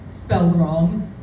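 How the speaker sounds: normal talking voice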